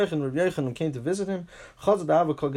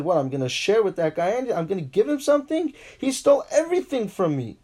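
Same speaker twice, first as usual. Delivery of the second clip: high, incredulous protest on someone's behalf; acted indignation; 5 semitones higher, 3 dB louder than usual. Accused landowner answers the rabbis about his thieving sharecropper, indignant. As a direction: protest it as the wronged man, climbing much higher.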